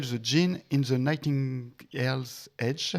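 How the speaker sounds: English title in a heavy French accent. Real, not acted. Reading a novella's English title to co-hosts, French accent. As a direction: slowly pick through English with a French accent. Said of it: "Pardon pour mon, mon accent".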